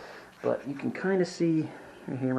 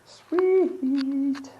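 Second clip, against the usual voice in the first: excited; 4 dB louder than usual. Real, not acted. Pleased finishing step.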